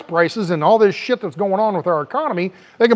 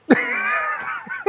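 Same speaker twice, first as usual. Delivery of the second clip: high breathy laugh; 5 dB quieter than usual. Real, not acted. Laughing at own impression.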